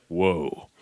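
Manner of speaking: low character voice, astonished; Yami. Acted. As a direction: play a deep-voiced cartoon hero taken aback. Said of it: "Yami pulls it out and he's like".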